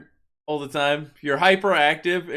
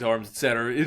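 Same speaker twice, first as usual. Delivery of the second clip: ordinary talk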